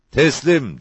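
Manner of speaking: shouted surrender. A narrator's reading. Outlaw calling to the sergeant from the cave, shouting. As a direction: call it out with pitch lifted. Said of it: "diye bağırdı".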